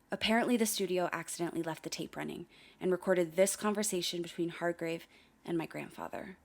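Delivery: ordinary talk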